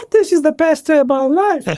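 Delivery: ecstatic. Retold or imitated. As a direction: burst out like your dream just came true. Ecstatic at president's announcement.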